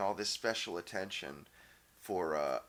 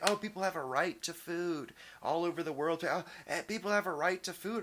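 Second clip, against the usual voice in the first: higher, mocking sing-song of complainers; 6 semitones higher than usual. Man mocking people who claim a right to food, higher sing-song sneer. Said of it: "and people say".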